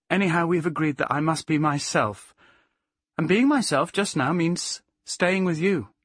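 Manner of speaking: decided Plaxy. Acted. Plaxy telling Sirius she must be herself, decided.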